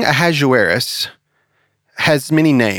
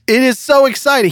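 excited; 12 semitones higher, 3 dB louder than usual. Acted. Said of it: "He's like".

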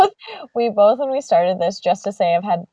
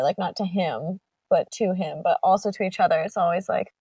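ordinary talk

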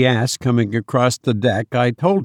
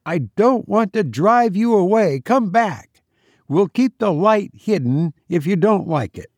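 calling out; 7 semitones higher than usual. A narrator's reading. Will calling to boat.

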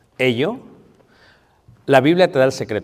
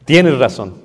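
tienes razón said louder and firmly, clearly spoken; firm admission; 6 dB louder, slower than usual. Shown as a demonstration. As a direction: say it plainly and firmly, mastering yourself. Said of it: "pero domínalo". (admitirlo con firmeza, en voz más alta)

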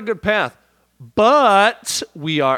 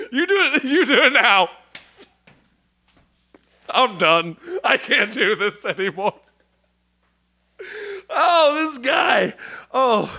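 laughing helplessly; 5 semitones higher than usual. Real, not acted. Laughing can't continue.

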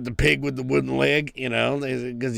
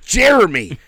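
usual speaking voice

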